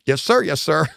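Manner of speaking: playful hammed-up obedience; mock obedient crew. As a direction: snap to attention for the boss. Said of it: "And everybody went".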